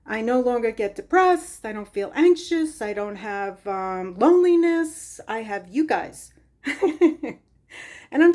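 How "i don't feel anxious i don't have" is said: usual speaking voice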